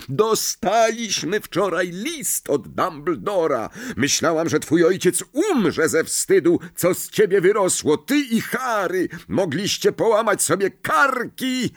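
high, outraged scolding with hard bursts; shrieking rant. A narrator's reading. Mother's letter shames Ron over the car, shrieking. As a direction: scold furiously, pitch climbing to the end. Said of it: "Wrzaski pani Weasley".

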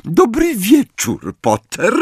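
high, slow sugary teacher voice; sickly sweet, drawn-out greeting; Umbridge sweetness. A narrator's reading. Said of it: "profesor Umbridge przemówiła".

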